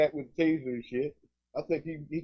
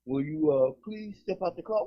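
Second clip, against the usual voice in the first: polite cop; 4 semitones higher than usual. Retold or imitated. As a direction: ask like a cop being extra courteous. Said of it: "And they say".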